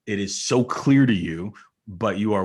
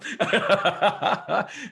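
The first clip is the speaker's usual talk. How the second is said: bright, high burst of laughter; 12 semitones higher than usual. Real, not acted. Laughs after the plug.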